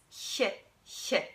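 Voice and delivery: breathed soft ch; soft German ch; soft ich sound. Shown as a demonstration. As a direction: hiss it gently at the front of the palate. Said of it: "so it's".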